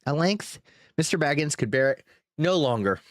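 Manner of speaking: usual speaking voice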